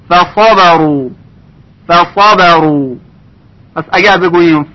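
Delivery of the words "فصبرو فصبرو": فصبرو with light ف, heavy ص, light ب, slow; careful Quran recitation. Shown as a demonstration. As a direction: articulate فصبرو syllable by syllable.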